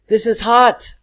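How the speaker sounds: high, loud acted outcry; exclaimed, strong feeling. Shown as a demonstration. Teacher shows pupils a true exclamation, strongly exclaimed. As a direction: exclaim it as if it really burns. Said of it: "because it's a strong feeling".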